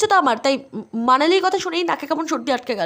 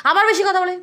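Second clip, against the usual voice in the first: usual speaking voice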